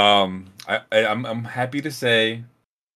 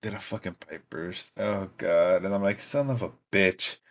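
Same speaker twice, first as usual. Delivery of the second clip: dreading mutter; 3 semitones lower, 7 dB quieter than usual. Retold or imitated. Dreading a burst pipe.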